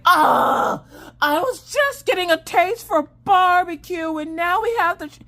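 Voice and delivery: high, loud mock wail of a kid; child's tantrum. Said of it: "Temper tantrum, breakdown".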